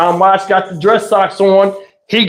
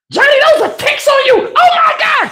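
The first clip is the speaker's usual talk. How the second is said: shocked shriek. Retold or imitated. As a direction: squeal in horror at the ticks. Aunt panics seeing ticks.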